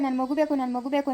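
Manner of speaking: a lightly voiced weeping mother, low and soft; mock crying mother. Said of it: "ಅವಳು ಅಲ್ಲಿ ಅಳ್ತಾವಳೆ". (ಅಳುವ ತಾಯಿಯನ್ನು ಮೆಲುದನಿಯಲ್ಲಿ ಅನುಕರಿಸು)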